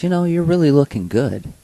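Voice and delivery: quoted great-great-grandmother. Retold or imitated. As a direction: lift the voice for her quoted compliment. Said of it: "And she goes".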